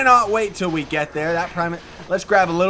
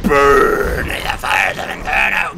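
low, slow booming villain proclamation; mock villain; 8 semitones lower, 4 dB louder than usual. Gamer hamming it up at enemies, low slow villain boom. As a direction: declaim it like a demon lord.

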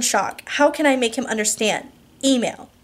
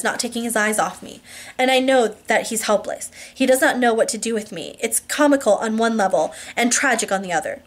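ordinary delivery

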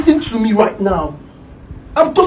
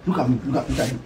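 soft plea; 10 semitones lower, 12 dB quieter than usual. Acted. Husband pleads softly.

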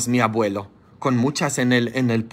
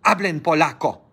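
ordinary talk, nothing marked

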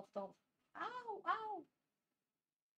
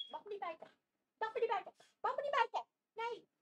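rising protest; 8 semitones higher, faster than usual. Real, not acted. Dog biting, escalating protest.

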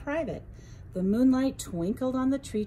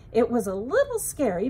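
ordinary delivery

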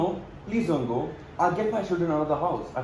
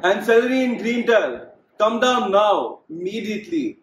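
high, loud call up the stairs, slow on come down now; 7 semitones higher, 6 dB louder than usual. Acted. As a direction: summon the kids from below. Father calling children downstairs.

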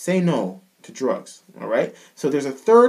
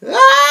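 very high, loud sustained growled "ah"; head voice growl; 13 dB louder than usual. Shown as a demonstration. Coach demonstrating a mixed voice growl, high growled wail. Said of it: "a head voice growl or a mixed voice growl sounds like".

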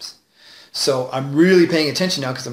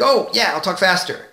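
excited burst, pitched higher; 4 semitones higher than usual. Acted. Speaker showing how he talks when worked up, excited. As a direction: jump in with eager excitement. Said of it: "If I get excited".

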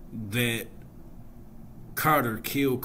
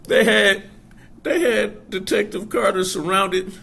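laughing through words; 9 semitones higher, 8 dB louder than usual. Real, not acted. Still laughing while telling.

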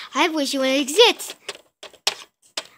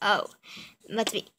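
normal talking voice

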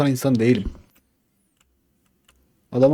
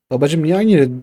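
ordinary talk

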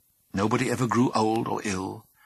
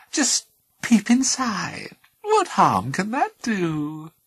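purring little voice; 6 dB louder, slower than usual. Acted. Chest voice tempting.

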